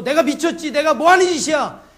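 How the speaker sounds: loud, high self-rebuke; re-enacted outburst of coming to one's senses; acted sudden realisation. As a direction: shout it at yourself in shock. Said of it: "메시지를 보면서".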